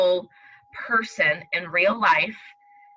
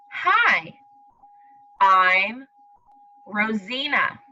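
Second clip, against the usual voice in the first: put-on slow storybook reading to children; reading aloud; much slower than usual. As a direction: read it slowly, like to little kids. Teacher reads story aloud.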